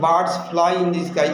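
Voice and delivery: each word spaced out, very slowly; slow dictation. Shown as a demonstration. Teacher dictating an example sentence to students, slow and deliberate. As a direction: read it slowly for the class to copy. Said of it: "কিন্তু অথচ".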